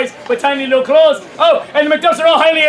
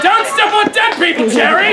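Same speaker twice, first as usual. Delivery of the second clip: high, called-out reprimand; exasperated stage-director shout; mock scolding; 5 semitones higher than usual. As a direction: holler a comic warning across the stage. Calls out scolding an actor.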